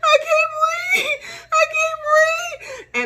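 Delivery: high, loud, slow crying panic; panicked choking cries. Shown as a demonstration. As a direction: cry out gasping, half laughing. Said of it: "I'm shaking and at the same time I'm".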